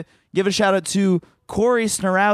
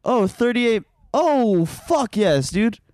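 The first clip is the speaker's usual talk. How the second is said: delighted shouting of good news; excited; 7 semitones higher than usual. Real, not acted. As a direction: pitch jumps up, thrilled. Thrilled that it is close by.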